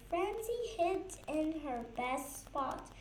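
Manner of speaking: ordinary delivery, nothing marked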